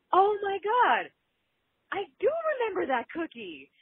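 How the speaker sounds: high, thrilled sudden recall; excited. Retold or imitated. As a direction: gasp it out with delight. Caller recalls sudden memory.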